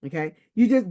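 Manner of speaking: usual speaking voice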